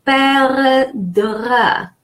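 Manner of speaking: "perdre" drawn out very slowly, open E as in egg; French pronunciation. Shown as a demonstration. Teacher breaking down the word for learners, "perdre" slowly sounded out. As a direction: stretch "perdre" syllable by syllable. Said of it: "E followed by two consonants".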